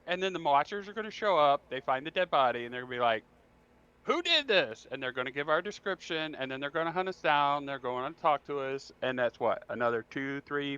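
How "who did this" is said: high-pitched imitation of an investigator; mock demanding. Watchers finding the dead body, mock demanding. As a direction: demand it in a put-on raised pitch.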